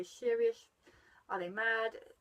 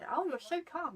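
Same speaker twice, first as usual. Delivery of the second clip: mock admiring gush, pitched higher; admiring onlookers; 8 semitones higher than usual. People praising the calm mum, high mock gush. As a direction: gush like an impressed bystander. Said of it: "people think".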